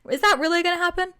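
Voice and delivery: high, loud mock-incredulous question; teasing mockery. Making fun of her anxiety a little bit, teasing. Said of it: "make fun of her a little bit".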